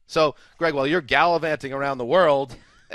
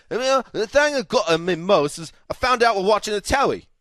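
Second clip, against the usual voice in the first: hammed-up British accent, higher and louder; a cartoonish British accent as a cut player; bad British accent; 5 semitones higher, 5 dB louder than usual. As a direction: play a footballer in an over-the-top British accent. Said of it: "That is probably the worst British accent I've ever heard".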